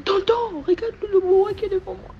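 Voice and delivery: high, loud mock cry of alarm; retold alarmed call. Dream retold, calling uncle at boa.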